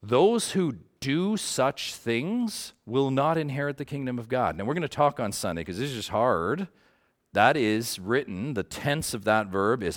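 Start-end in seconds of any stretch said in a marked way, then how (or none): 0.0-4.6 slow, weighty emphasis on Those; solemn scripture reading. Shown as a demonstration. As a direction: lean on "Those", drawn out and grave. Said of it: "Look at what Galatians five twenty-one says".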